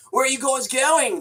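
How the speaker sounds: high mock voice of a clueless kid; hammed-up high kid voice. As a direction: play the lost kid whining. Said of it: "is just like the".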